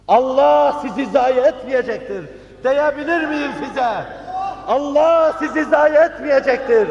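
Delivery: very high, forceful proclamation; impassioned cry. Real, not acted. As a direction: cry it out to the congregation. Calm question repeated as impassioned cry. (coşkuyla haykırarak)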